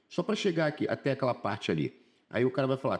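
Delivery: usual speaking voice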